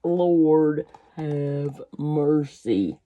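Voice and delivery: slow amazed lord have mercy; slow drawn-out exclamation. Real, not acted.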